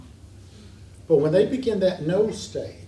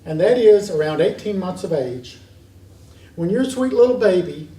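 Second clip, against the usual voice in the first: normal talking voice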